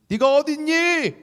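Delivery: high, loud, slow anguished call; God crying out. Acted. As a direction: call out desperately for the lost one. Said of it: "하나님 외치십니다".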